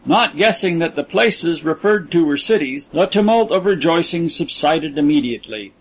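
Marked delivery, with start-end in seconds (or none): none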